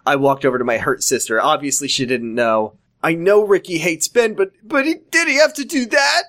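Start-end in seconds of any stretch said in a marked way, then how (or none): 3.0-6.2 high, distressed protest without full volume; mild mock scream. A narrator's reading. Sister protesting over her beaten boyfriend, high mild mock scream. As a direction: climb higher into a hammed-up wail. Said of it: "Ashley screamed".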